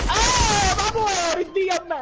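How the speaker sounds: high yelled outburst; explosive italian cursing. Real, not acted. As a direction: blow up at the screen. Gamer raging during a fight.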